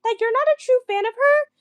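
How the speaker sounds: high, squeaky mocking voice of a gushing fan; mocking quote. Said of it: "this broad was like".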